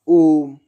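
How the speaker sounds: a loud, held ओम; long loud om. Shown as a demonstration. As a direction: chant ओम out long and full. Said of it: "दीर्घ से भी अधिक समय लगा है".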